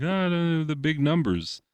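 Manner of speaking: high, wistful mock sigh over numbers. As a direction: imitate the dreamy fans. Mimicking people wanting numbers.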